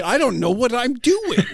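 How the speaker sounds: a laughing, high mock panic; flustered self quote. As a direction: play your younger clueless self, laughing. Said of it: "'cause I was like".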